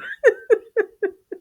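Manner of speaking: a very high, squealing laugh. Real, not acted. Laughing at her own story for viewers, squealing laugh. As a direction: shriek with laughter.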